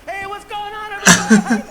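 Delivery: loud high showbiz greeting; stage greeting. Retold or imitated. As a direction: belt it like an MC opening. Comedian's stage greeting mocked.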